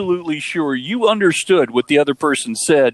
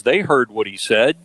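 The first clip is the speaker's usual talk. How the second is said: normal talking voice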